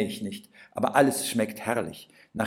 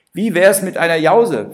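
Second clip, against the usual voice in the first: cheerful cook; 7 semitones higher, 11 dB louder than usual. Acted. Austrian cook offers snack.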